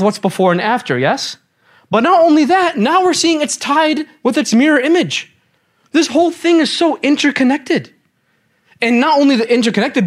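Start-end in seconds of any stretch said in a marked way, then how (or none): 1.9-10.1 excited. Real, not acted. Teacher marvels at the pattern.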